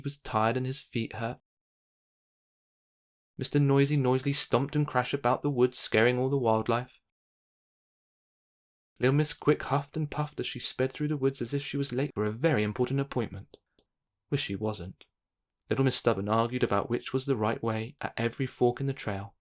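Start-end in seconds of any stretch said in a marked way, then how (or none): none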